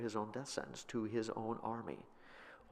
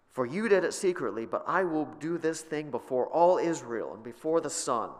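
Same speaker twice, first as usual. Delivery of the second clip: higher, louder prophetic proclamation; forceful scripture reading; 5 semitones higher, 7 dB louder than usual. Acted. Preacher reading Nathan's rebuke to David, loud proclamation. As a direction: thunder the prophet's words.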